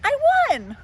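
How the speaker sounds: high, loud gleeful shout; a kid bursting with victory; triumphant cheer. Acted. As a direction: crow about winning. Child brags winning game.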